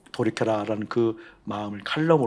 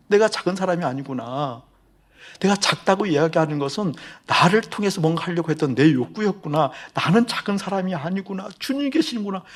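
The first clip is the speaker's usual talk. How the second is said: higher and louder, building to a joyful peak; rising joy; 7 semitones higher, 4 dB louder than usual. Retold or imitated. Pastor relives his elevator realization, climbing with joy. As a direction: let the realization lift the voice.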